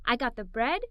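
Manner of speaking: usual speaking voice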